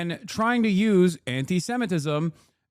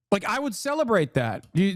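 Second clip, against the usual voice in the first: ordinary delivery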